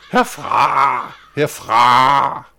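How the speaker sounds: high, loud bird cry; screeching birds. Acted. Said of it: "skreg de store sorte fugle". (skrigende fugle)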